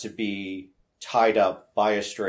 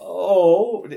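mock surprise; 12 semitones higher, 8 dB louder than usual. Reacting to the stand-down text.

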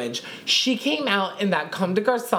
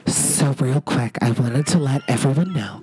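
distant announcer voice; 6 semitones lower, 3 dB louder than usual. Retold or imitated. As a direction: sound far from the mic. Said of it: "Brooklyn Ford is literally like".

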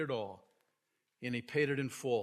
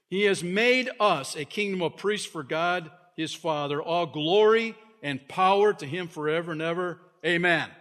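louder, ringing doxology; proclaiming scripture; 3 semitones higher, 6 dB louder than usual. Acted. Preacher reading John's praise to the church, ringing out. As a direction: build the glory line up to the amen.

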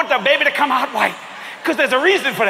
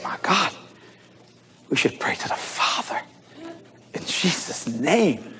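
whispered prayer, drawn out slowly at the end; hushed prayer; 5 semitones lower, 8 dB quieter, slower than usual. Retold or imitated. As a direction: breathe it like a reverent secret. Quoting a woman praying hushed.

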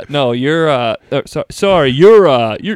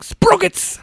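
Mike Myers impression. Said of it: "he's always just like".

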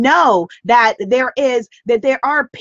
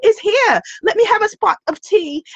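playful, badly faked British accent; mock-British, higher at the start; terrible British accent; 8 semitones higher than usual. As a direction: put on a hammy British accent. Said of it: "my terrible British accents".

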